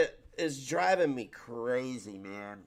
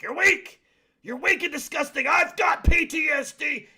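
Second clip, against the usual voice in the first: high, loud mocking parody of her shouting; mocking impression; 11 semitones higher, 6 dB louder than usual. Mocking the counselor to his viewers, high and loud. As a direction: shriek it back at her like a ridiculous bully. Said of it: "And you're like".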